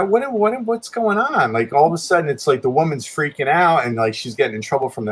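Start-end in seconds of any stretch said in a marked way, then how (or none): 0.0-1.5 stammered high-pitched confusion; bewildered. Retold or imitated. Storyteller recalls the weirdest thing ever, bewildered. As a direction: re-enact baffled disbelief, voice pitched up. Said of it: "it was really weird".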